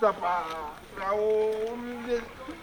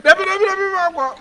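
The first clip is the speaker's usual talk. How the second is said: loud refusal; 9 semitones higher, 5 dB louder than usual. Acted. Refusing loudly.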